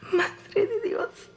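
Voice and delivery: high, slow, crying plea to God; choked Spanish prayer in shock; tearful cry. Acted. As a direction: let it break into a sob. Ghost learns family may be alive.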